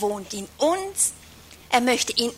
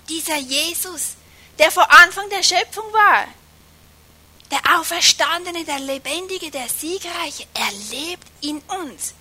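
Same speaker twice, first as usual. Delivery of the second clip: fervent preaching; 4 semitones higher than usual. Acted.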